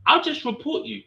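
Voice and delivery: high sing-song sarcastic tone; mock sweet reply. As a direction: play it falsely sweet and sarcastic. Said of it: "I said".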